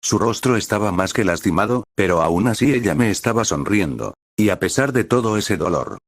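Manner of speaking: ordinary delivery, nothing marked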